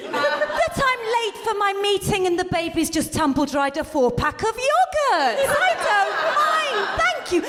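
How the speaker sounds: high, slow sarcastic gratitude. Pretend. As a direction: gush with mock relief.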